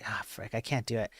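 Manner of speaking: breathy unvoiced frick under the breath; whispered frustration. Real, not acted. Streamer giving up on his accent, whispering in frustration. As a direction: curse softly at yourself.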